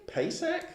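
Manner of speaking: Pasek guessed as PAY-sek, shooting up high; unsure pronunciation. Real, not acted. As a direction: try Pasek in two syllables as a high question. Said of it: "I don't know this word right".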